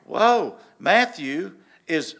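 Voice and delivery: loud, high burst of amazement, then drawn out slowly; like a sudden realisation hitting him; surprised whoa. Retold or imitated. Sudden discovery about Matthew.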